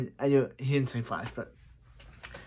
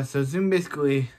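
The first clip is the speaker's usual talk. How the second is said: ordinary talk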